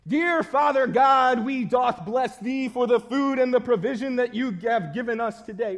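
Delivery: loud, high, hammed-up pious praying; mock a friend praying far too solemnly; overly serious prayer. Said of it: "takes it just a little too seriously".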